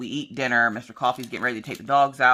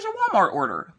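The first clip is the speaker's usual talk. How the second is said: chirpy repeat; 7 semitones higher than usual. Retold or imitated. Courier repeats the order.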